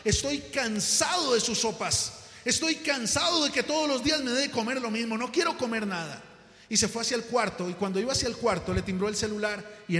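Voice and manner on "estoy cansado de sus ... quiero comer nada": higher, exasperated husband; irritated complaint. Acted. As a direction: act fed up, pitch raised. Husband rejects wife's soup. (queja irritada de marido harto)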